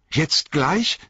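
put-on high, startled young hobbit; surprised cry. A narrator's reading. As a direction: blurt it out in dismay. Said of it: "rief Frodo".

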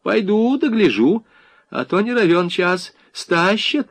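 high, bustling housewifely suspicion. A narrator's reading. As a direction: announce that you will check on them. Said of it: "перешла в наступление хозяйка". (высоко, осмелев, по-хозяйски)

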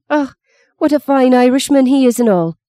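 a housekeeper swooning over Luke, in a lilting Irish brogue; ecstatic Irish sigh. A narrator's reading. Cook gushes to Meggie about Luke, ecstatic Irish brogue. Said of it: "Minnie sighed ecstatically".

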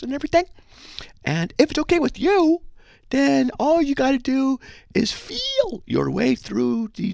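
exaggerated meek falsetto, slow and drawn out; oversensitive tiptoeing. Shown as a demonstration. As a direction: tiptoe round them as if afraid to offend. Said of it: "I gotta talk like this".

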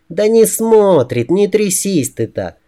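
high, drawn-out female reassurance; exasperated woman. A narrator's reading. She calms him passing the monk, high and exasperated. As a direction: brush off the fear in a high voice.

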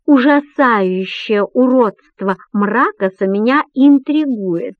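slow, every syllable spelled out; articulated. Acted. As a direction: enunciate each word deliberately. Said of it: "Членораздельно, стараясь ничего не упустить".

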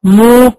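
مو with the lips rounded forward; مو with the lips pushed round into a full u; damma meem demo. Shown as a demonstration. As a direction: round the lips after touching them for مو. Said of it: "هلمس".

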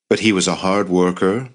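plain reading voice

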